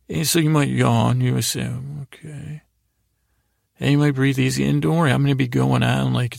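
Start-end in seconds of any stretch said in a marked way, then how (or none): 2.0-2.6 drowsy yawned okay. Retold or imitated. Sleepy listener yawning.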